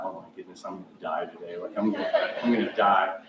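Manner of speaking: low, hushed retold thought; muted dread. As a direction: murmur it to yourself with a half laugh. Retold dread on seeing coach.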